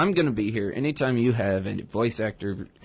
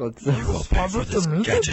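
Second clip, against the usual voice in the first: cartoon villain voice; 8 semitones higher, faster than usual. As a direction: shake your fist like Dr. Claw. Inspector Gadget villain quote.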